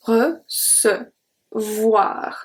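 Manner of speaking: Recevoir very slowly, C said like S; slow model word. Shown as a demonstration. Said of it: "the C is pronounced like a S".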